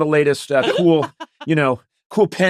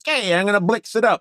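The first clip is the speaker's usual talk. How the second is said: higher-pitched, hammed-up store entrance; mock-swaggering customer; 6 semitones higher than usual. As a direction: announce yourself with silly swagger. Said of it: "I go in and I go like".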